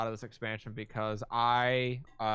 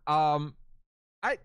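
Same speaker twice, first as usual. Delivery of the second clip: ordinary delivery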